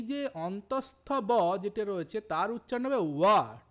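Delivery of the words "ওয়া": ওয়া loud, with a W glide. Shown as a demonstration. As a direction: demonstrate ওয়া starting like English W or V. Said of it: "এটার উচ্চারণ হবে ডাব্লু অথবা ভি এর মতো".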